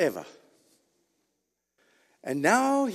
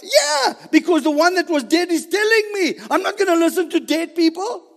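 loud, high, insistent preaching; emphatic retort; 9 semitones higher, 6 dB louder than usual. Real, not acted. Preacher answers the doubter about the living one, emphatic retort. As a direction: fire back with full conviction.

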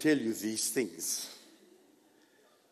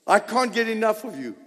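bright, playful, a bit higher and fast; teasing; 5 semitones higher, faster than usual. Shown as a demonstration. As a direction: tease the wife with it. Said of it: "I'm teasing you".

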